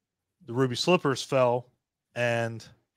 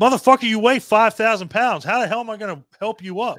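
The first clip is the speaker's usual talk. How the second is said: exasperated retort; 7 semitones higher, 6 dB louder than usual. Retold or imitated. Dorothy voiced lifting heavy TikTok.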